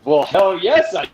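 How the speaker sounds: thrilled mock-eager reply; excited. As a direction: gush with slow, emphatic delight. Retelling his thrilled reply to offer.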